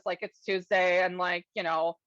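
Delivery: usual speaking voice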